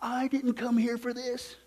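slow, mock indignant complaint; a hint of an offended churchgoer; woman after service, mildly mock indignant; imitating indignant woman. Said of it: "she says".

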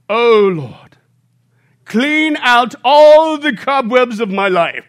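slow, loud, pious British-tinged prayer voice; pompous deacon prayer. Retold or imitated. Old deacon ending the prayer meeting, slow and loud. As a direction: pray grandly like a puffed-up deacon. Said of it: "he always ends with these words".

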